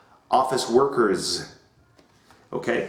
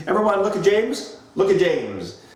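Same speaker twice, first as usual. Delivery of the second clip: classroom teacher voice; 7 semitones higher than usual. Shown as a demonstration. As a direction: address the class like young learners.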